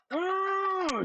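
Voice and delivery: chef-mode battle cry. Pretend. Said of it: "gonna go all chef mode".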